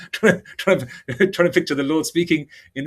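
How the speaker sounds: words broken up by real giggles; real laughing. Real, not acted. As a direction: crack up at your own joke. Cracks up at own joke.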